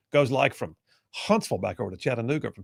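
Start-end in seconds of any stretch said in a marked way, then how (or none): none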